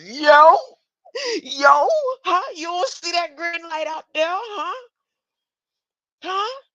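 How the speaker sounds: shouting yo at the viewers; loud call-out. Real, not acted. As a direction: call out to the chat. Calling out at viewers.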